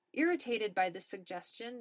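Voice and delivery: plain reading voice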